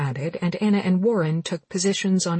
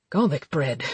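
drawl; 4 semitones lower than usual. Acted. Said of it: "drawled Warren".

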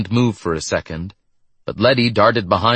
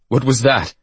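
higher, alarmed question; startled gasp; 4 semitones higher than usual. A narrator's reading. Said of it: "the amnesiac gasped".

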